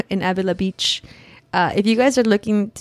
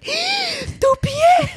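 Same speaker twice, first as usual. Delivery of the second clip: very high mock shriek; pitched-way-up comic horror; imitating horrified mom. Mom gasps at swollen foot.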